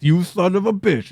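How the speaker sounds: mock macho greeting; Predator quote. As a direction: quote the movie handshake with gusto. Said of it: "the scene from Predator".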